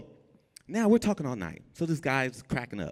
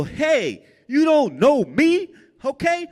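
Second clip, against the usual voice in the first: much higher and louder, bluffing tough; scared man yelling fake tough-guy threats; tough talk; 8 dB louder than usual. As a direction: fake bravado shouted at Rambo. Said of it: "I'm just talking all kind of junk".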